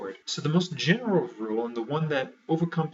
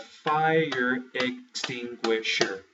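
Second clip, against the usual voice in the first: broken into beats; 3 dB louder, much slower than usual. Shown as a demonstration. Teacher counting out the beats of a word, slow syllable-by-syllable demo. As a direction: FIRE EXTINGUISHER drawn out slow, syllable by syllable. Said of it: "It is only one beat".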